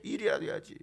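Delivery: soft, toned-down echo of the demand; muted repeat. Acted. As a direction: say it under your breath. Same demand repeated as aside. (작게 혼잣말처럼)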